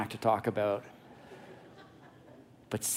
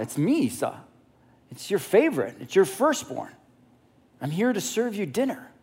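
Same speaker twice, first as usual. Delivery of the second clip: insistent impostor son; 7 semitones higher than usual. Acted. Jacob poses as favorite son.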